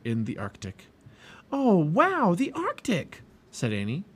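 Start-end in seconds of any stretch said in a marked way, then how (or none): 1.5-2.3 high, loud burst of wonder; thrilled. A narrator's reading. Annie thrilled by book title. 2.3-3.2 put-on high, excited girl's exclamation; thrilled. A narrator's reading. Annie thrilled by book title.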